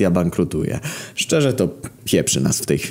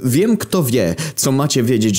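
eager panting; 8 semitones higher than usual. Acted. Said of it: "Wysapał pełen entuzjazmu Grek".

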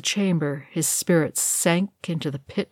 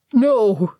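louder, breaking howl of grief; anguished cry; 5 semitones higher, 5 dB louder than usual. A narrator's reading. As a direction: cry out in raw loss. Gimli grieving at tomb.

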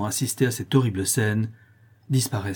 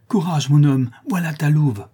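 higher, louder call of encouragement; rallying cry; 5 semitones higher, 6 dB louder than usual. A narrator's reading. La Louve to her trapped lover, rallying shout. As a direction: call out courage through the door. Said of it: "s'écria-t-elle".

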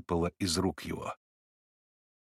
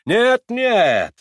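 reassuring; 12 semitones higher, 9 dB louder than usual. A narrator's reading. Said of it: "ободрительно трепля старика по плечу".

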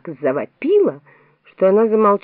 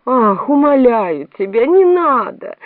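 high, loud, slow pleading; desperate cry; 5 semitones higher, 5 dB louder, slower than usual. A narrator's reading. The Queen begs Alice not to cry, desperate plea. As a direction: beg loudly, voice climbing in despair. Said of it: "закричала королева в отчаянии".

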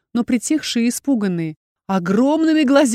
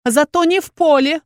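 much higher, louder furious outburst; angry shout; 8 semitones higher, 6 dB louder than usual. A narrator's reading. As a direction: yell it, fed up. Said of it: "разозлённая выкрикнула я".

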